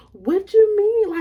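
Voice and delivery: high, drawn-out "what do you mean"; high-pitched baffled questioning; incredulous friend. Retold or imitated. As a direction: imitate a friend in loud, stretched disbelief. Friend's disbelief at locked door.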